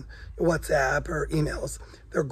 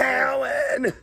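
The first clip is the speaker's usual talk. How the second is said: a nasal-free but higher shrill-style call of Howard! up high; Mrs. Wolowitz; 7 semitones higher than usual. Retold or imitated. Imitating Mrs. Wolowitz calling her son, higher. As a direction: yell Howard! like a New York mom. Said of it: "That's what I sound like".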